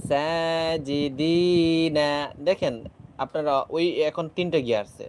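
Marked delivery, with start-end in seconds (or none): 0.1-2.1 long drawn-out madd on জাহিদিনা; elongated vowel. Shown as a demonstration. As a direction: stretch the vowel one alif long. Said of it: "এক আলিফ লম্বা করে পড়ব". (টানা দীর্ঘ উচ্চারণ)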